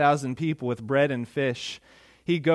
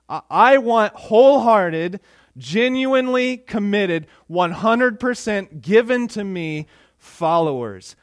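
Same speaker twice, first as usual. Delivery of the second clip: emphatic demand; 5 semitones higher, 7 dB louder than usual. Retold or imitated. Jesus demanding wholehearted followers.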